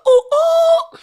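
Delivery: high, loud "ooh" shout-out; excited whoop. Real, not acted.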